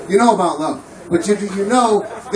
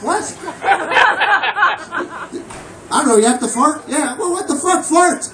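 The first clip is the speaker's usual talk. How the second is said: incredulous what; 8 semitones higher than usual. Retold or imitated. Dad's incredulous reaction.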